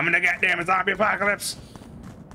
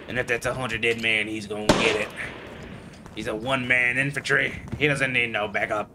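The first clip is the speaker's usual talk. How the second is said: usual speaking voice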